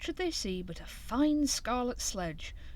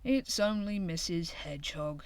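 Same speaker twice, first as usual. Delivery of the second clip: usual speaking voice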